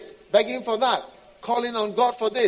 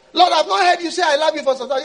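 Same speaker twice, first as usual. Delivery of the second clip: high, loud, fast complaint; hurt pleading believer; 8 semitones higher, 10 dB louder, faster than usual. Acted. Believer complaining God no longer says I love you. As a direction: plead to hear love again.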